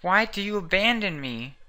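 a wounded mother's loud reproach; accusing mother. Acted. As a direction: hurl the blame at your son. Voicing mother in game quarrel.

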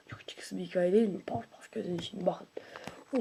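normal talking voice

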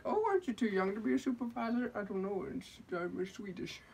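high, silly fake Swedish customer; badly faked Swedish accent; mock Swedish voice. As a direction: mimic a complaining Swede way up high. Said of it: "making stuff up as I go with the voice".

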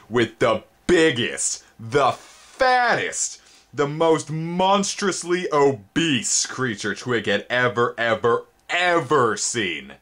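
high, slow, drawn-out awe; high slow storyteller's awe; dramatic reveal. A narrator's reading. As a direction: stretch each word with wonder.

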